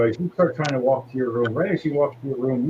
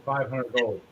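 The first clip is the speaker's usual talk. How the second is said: normal talking voice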